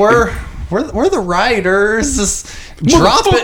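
mock-nervous rider begging to drop it; nervous passenger. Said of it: "sitting in the back seat going".

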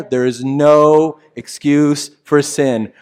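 slow group recitation, "no" stressed; said together. Shown as a demonstration. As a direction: recite "There is no excuse for sin" slowly, leaning on "no". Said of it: "Can we say that together?".

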